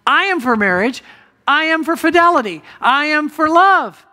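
preacher mimicking a false teacher's slogans, mildly mocking; mocking quote. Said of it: "Look at the last four lines".

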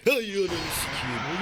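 slow, drawn-out annoyed curse; frustrated swearing. Real, not acted. Game character gets caught suddenly.

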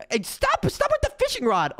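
high-pitched frantic protest; mock distress. Gamer being yanked by fishing rod, protesting. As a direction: plead with a squeal.

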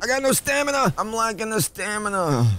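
high whiny complaint; mock whine. Real, not acted. Gamer out of stamina, whining high.

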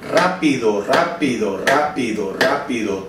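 evenly chanted rápido, one per beat; rhythmic syllable counting. Shown as a demonstration. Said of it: "en un pulso voy a hacer tres sílabas".

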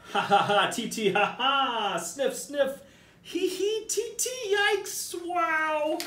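squeaky high monkey chatter and giggles; high cartoon monkey tittering. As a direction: play a giddy little monkey laughing. Silly monkey chatter before story.

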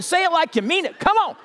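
ordinary talk, nothing marked

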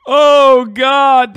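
high, loud exclamation; big exasperated wail; loud exasperation. Real, not acted. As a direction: cry it out in disbelief after laughing. Exasperated after laughing.

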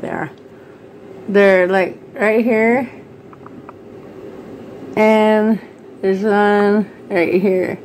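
talking while showing teeth; finger in mouth. Real, not acted. Seen 3: Finger holds lower lip down, showing teeth, throughout the labelled words.

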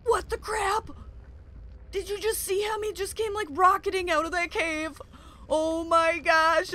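high, loud, astonished outburst; shocked. Real, not acted. Player seeing a creature rocket from the cave, shocked. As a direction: blurt it out in real alarm.

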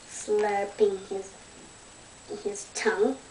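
ordinary delivery, nothing marked

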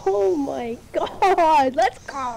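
high stretched victory cry; shrieking jackpot winner. Real, not acted. Celebrating win.

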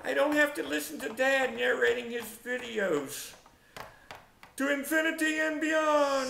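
high, elated declaration building to a shout; triumphant high holler at the end; gleeful proclamation. Acted. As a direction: announce it like a cartoon hero launching off. Playful cheer at escaping Dad's narrating.

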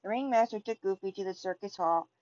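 ordinary delivery, nothing marked

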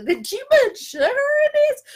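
high, loud mock-indignant question; hammed-up accusing squeal. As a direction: act out the suspicious sniff at the eggs. Retelling confronting husband over eggs.

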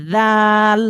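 জাল with the tongue tip held between the teeth, th-like. Shown as a demonstration. Teacher demonstrates the letter sound to students. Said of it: "তখন আর জিহ্বা চেপে ধরার দরকার নেই".

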